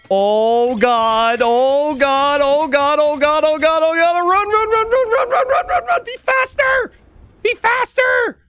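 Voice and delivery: rising frantic shriek, very high; panicked screaming. Real, not acted. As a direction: scream them to safety. Caster urges fleeing villagers.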